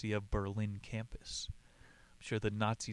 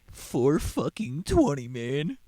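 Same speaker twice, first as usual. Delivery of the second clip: slow, much louder, higher in awe; amazed outburst; 4 semitones higher, 9 dB louder, slower than usual. Real, not acted. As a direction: spit out the time in disbelief. Marvels at the record time.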